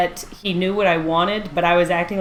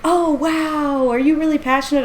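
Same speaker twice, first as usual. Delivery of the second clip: higher-pitched, lightly cooing host; mock impressed host; 7 semitones higher than usual. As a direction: react with put-on wonder. Panel host reacting, acted.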